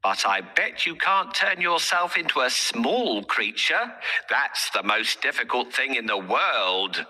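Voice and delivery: sly cat, coaxing the ogre. Acted. Puss tricks the ogre.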